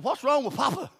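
hint of a three-year-old asking Grandma; little granddaughter. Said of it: "she said".

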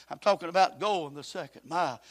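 normal talking voice

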